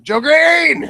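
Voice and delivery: high, loud announcer-like shout; calling out. Real, not acted. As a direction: shout the name like an introduction. Calls on next person for plug.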